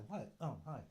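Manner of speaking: normal talking voice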